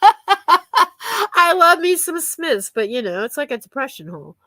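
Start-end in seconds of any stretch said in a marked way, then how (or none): 0.0-2.7 laughing. Real, not acted. Laughing about the Smiths.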